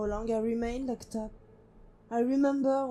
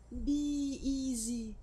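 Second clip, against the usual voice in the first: slow echo; 2 semitones higher, 4 dB quieter, much slower than usual. Acted. Voice repeating in her memory.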